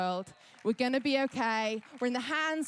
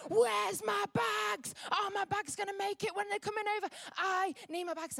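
much higher mock panic, half-shouted; imitating a panicked woman; 10 semitones higher than usual. Woman freaking out at airport staff, high panicked mock yell. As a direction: play the frantic passenger begging for bags. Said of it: "She is freaking out".

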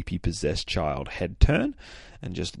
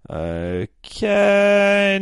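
high, drawn-out mock okay; mock-obedient high squeal; grudging compliance; 13 semitones higher, 6 dB louder than usual. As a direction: stretch it out high and reluctant. Mimics stubborn girl's reluctant reply.

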